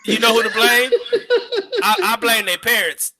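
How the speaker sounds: loud and high, laughing through it; chuckling joke. Real, not acted. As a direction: deliver the punchline cracking up.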